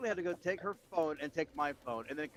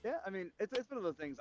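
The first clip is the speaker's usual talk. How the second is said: ordinary talk, nothing marked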